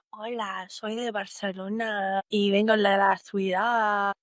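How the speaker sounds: slow Spanish spoken in a Barcelona reporter style; a Spanish speaker from Barcelona, unhurried; Barcelona Spanish accent. Shown as a demonstration. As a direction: introduce yourself as someone from Barcelona, slowly.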